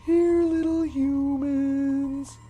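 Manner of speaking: high, slow sing-song; slow eerie high coaxing call; taunting monster call. Acted. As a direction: call out sweetly, hunting them. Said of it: "Estella Bear called".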